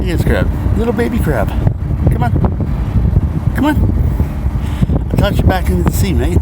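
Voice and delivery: high coaxing voice to a crab. Real, not acted. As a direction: sweet-talk a little critter.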